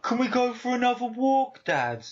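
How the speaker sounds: high, excitable mock Brummie dog; hyperactive Brummie. Dog begging owner for a walk, hammed-up Brummie. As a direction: plead like an eager dog with a Brummie accent. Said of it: "just imagine a Brummie going".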